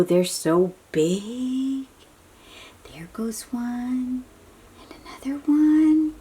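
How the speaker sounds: ordinary delivery, nothing marked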